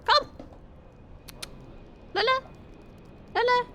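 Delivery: short, high, sing-out call; coaxing pet call. Real, not acted. Owner calls the hidden cat, high and coaxing.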